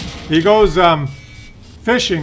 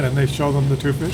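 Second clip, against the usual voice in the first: ordinary talk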